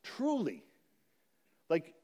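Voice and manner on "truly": Truly lifted high and stretched out; emphatic truly. Shown as a demonstration. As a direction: make Truly ring out. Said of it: "put that word in there".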